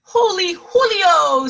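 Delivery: high, loud squeal, drawn out. A narrator's reading. Skippy seeing chihuahuas in the mirror, squealing high. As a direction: squeal with surprise. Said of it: "squealed Kitty Boy".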